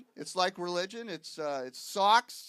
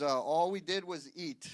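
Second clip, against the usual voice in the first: ordinary talk, nothing marked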